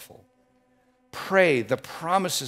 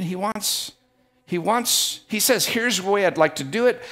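louder, driving sermon delivery; preaching; 4 semitones higher, 6 dB louder than usual. Acted. Pastor challenging the congregation about obedience, loud preaching. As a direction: build the point with full preaching force.

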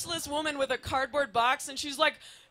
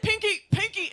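calling the cat; 8 semitones higher, 6 dB louder than usual. Retold or imitated. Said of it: "he's like".

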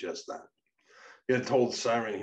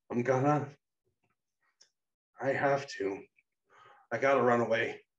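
higher, halting voice, pleading; strange, pleading; 5 semitones higher, 5 dB louder than usual. A narrator's reading. Troubled Payne tells Becca he must flee, pleading. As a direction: plead in broken starts, pitched up. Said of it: "seemed to be pleading with every word".

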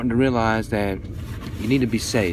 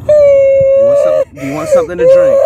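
frantic crying plea; 11 dB louder than usual. Real, not acted. Parent frantic over distressed child.

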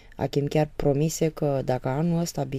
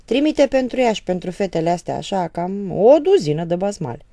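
loud, scornful order; father scoffing at the mother, loud and furious; furious mockery; 3 semitones higher, 9 dB louder than usual. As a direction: throw the words out in anger. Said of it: "cu un glas furios".

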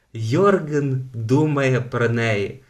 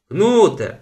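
loud, high call with falling end; father yelling; 8 semitones higher, 7 dB louder than usual. A narrator's reading. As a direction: shout his name from the porch. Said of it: "волав з веранди батько". (батько кличе з веранди)

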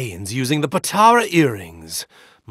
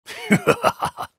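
a much higher, airy, scornful laugh; breathy high cackle of contempt; high mocking laugh. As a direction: laugh down at the enemy. Laugh in battle.

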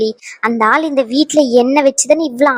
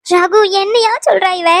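high-pitched, climbing bewildered question; high voice of a baffled mother; 6 semitones higher than usual. Acted. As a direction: appeal to the son in dismay, voice pitched up. Mother appeals to Raghu in dismay.

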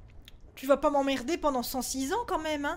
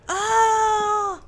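loud high scream of fright; terrified scream; 8 semitones higher, 10 dB louder than usual. Real, not acted. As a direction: scream at the jump scare. Crie de peur. (cri de terreur fort et aigu)

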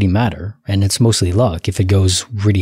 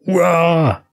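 higher-pitched drawn-out comic "wah"; mock startled wail; 6 semitones higher than usual. Host teasing how hilarious it looked to coworkers, mock wail. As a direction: imitate a flailing panic cry, hammed up.

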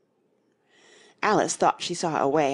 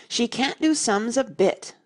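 "can't do sums a bit" raised and firmly stressed; higher, emphatic verdict on "sums a bit"; great emphasis; 7 semitones higher, 4 dB louder than usual. A narrator's reading. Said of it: "said together with great emphasis".

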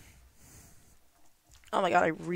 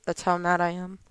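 ordinary talk, nothing marked

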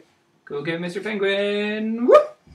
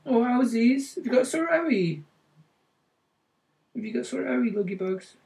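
kissing it better; 4 semitones higher, 5 dB quieter than usual. Real, not acted. Soothing child's sore hand.

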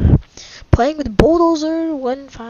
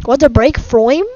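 exasperated outburst; 4 semitones higher, 8 dB louder than usual. Real, not acted. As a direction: raise your voice, stretching the key words. Fed up with repeated ad line.

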